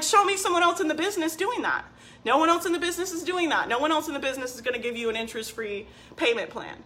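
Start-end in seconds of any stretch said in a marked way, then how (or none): 0.0-1.8 higher, daring challenge; challenging boast. Retold or imitated. Coach boasting about her offer to viewers, challenging. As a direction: dare them to find a better deal. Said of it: "And I'm like".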